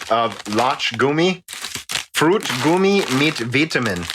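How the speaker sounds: put-on German pronunciation; American attempt at a German accent; reading German. Shown as a demonstration. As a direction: read the label aloud in German. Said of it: "see me read German".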